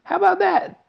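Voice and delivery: very high, loud gleeful question; triumphant boast. A narrator's reading. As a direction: crow it proudly. Wonka boasting.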